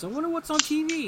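high, quick, singsong musing; Zan. Retold or imitated. Zan pushing buttons on the supercomputer, idly curious.

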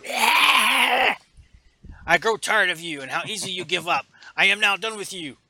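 loud fast furious yell; angry Vader shout. Retold or imitated. Vader losing patience with the officer, shouting. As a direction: blow up at the excuses.

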